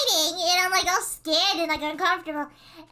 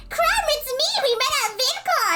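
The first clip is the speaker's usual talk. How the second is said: very high, excited imitation; mock eager fan; 11 semitones higher, 3 dB louder than usual. Actress walking over to the streamer, mock eager greeting. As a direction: greet them pitched way up.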